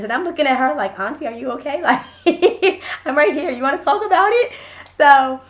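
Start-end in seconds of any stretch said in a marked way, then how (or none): 1.0-4.5 giggling check-in, pitch climbing; laughing, singling her out gently; amused mock concern. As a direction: tease a grumbling relative through laughter. Teasing the muttering aunt, giggling.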